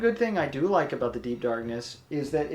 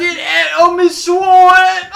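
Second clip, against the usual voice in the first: very high, very loud, slow squeal of an ogre, laughing; Shrek impression; 16 dB louder, slower than usual. Shown as a demonstration. Showing her partner how Shrek should sound, a shrieking laughing bellow. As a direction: show how the ogre line should sound, at top pitch. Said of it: "That's how you make it sound".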